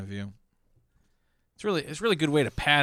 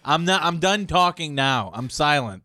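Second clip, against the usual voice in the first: huffy sulk; 3 semitones higher, 7 dB louder than usual. Retold or imitated. Mocking huffy silent person.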